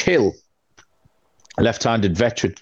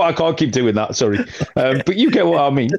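ordinary delivery

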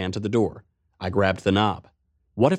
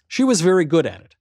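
ordinary talk, nothing marked

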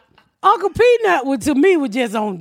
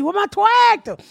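higher mock yell of the lost tractor; imitating the man, pitched up; 9 semitones higher than usual. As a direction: call out high for the tractor, hammed up. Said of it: "we repeat that the whole week".

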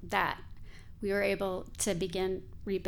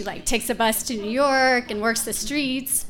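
normal talking voice

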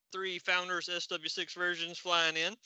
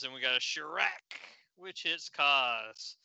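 normal talking voice

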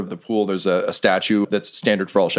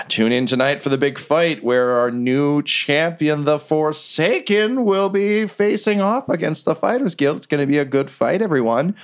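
higher, mock announcer hype; fight announcer; 6 semitones higher than usual. Brothel owner plugging tonight's fight to the crowd, hyped. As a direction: sell the big fight like a promoter.

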